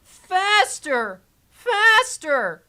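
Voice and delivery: put-on egging the ride on like a giddy kid; mock excited squeal.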